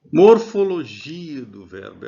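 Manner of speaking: Morfologia do verbo announced loud and slow; topic heading. Shown as a demonstration. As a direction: call out the heading. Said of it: "announcing new topic".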